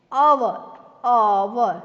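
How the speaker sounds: "અવર" begun on the vowel, silent H. Shown as a demonstration. Teacher modelling the word for learners, "અવર" with silent H. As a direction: say "અવર" loudly, with no H at the start. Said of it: "silent છે".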